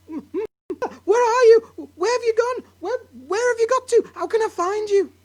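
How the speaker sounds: high, loud tearful calls; frantic calling. Acted. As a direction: call for her, near tears. Wife searches, calling for mother.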